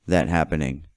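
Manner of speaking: ordinary talk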